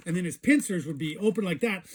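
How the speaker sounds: ordinary delivery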